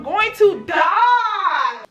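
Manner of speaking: slow, half-crying shout; scared she will die in the wreck; panicked cry. Retold or imitated. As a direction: wail it in fear. Trapped, fearing death.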